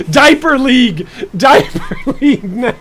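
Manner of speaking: cracking up while calling it out; helpless laughter breaking into the phrase; laughing. Real, not acted. Laughing at own joke.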